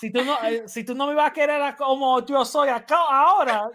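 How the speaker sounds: hammed-up passionate Spanish aside, fast; playful Latin lover. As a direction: tease it like a dramatic lover.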